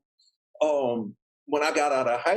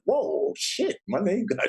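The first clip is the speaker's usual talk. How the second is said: high-pitched retold amazement; wowed at yourself, voice raised high; amazed realization; 7 semitones higher, slower than usual. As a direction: replay your astonishment, pitch leaping up. Amazed his name opens doors.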